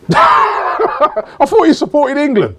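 high, drawn-out incredulous cry; laughing disbelief. Real, not acted. Host challenging co-host's loyalty to England, laughing disbelief. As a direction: protest through laughter, pitch shooting up. Teasing co-host who picks Arsenal.

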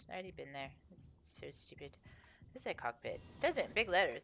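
normal talking voice

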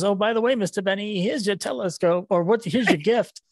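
high, meek little salesman voice; Peabody voice. Retold or imitated. Fan quoting the meek salesman to Mr. Benny, high and timid. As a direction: play a timid little man handing over a gift. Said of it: "he goes".

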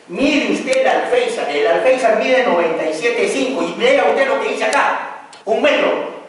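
high, loud angry complaint; imitating a fuming architect pointing at the error; heated architect. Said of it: "un acalorado".

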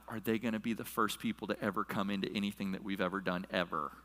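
low, quiet anxious thought; hushed wondering. Retold or imitated. Watching neighbors walk past on party day, hushed wondering. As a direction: wonder to yourself, low and soft. Voicing his thought at window.

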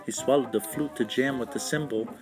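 ordinary delivery, nothing marked

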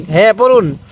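normal talking voice

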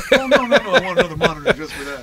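laughing through the words. Real, not acted. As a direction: giggle while protesting. Laughing at own joke.

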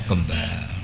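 usual speaking voice

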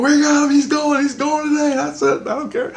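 very high, loud declaring voice; the other Christ, triumphant. Retold or imitated. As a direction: announce it with a squeaky triumph. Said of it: "the other Christ goes".

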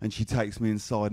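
ordinary delivery

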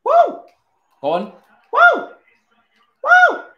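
very high, loud whoops; celebration whoops. Real, not acted. Gamer celebrating a goal, high whooping. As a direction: yelp with joy at the goal.

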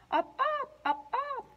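high up up calls; high frog calls; frog croak. A narrator's reading. Frog sound in story.

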